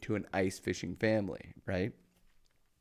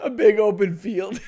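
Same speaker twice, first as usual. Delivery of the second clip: laughing repeat; 10 semitones higher, 9 dB louder than usual. Real, not acted. Repeating friend's words, laughing.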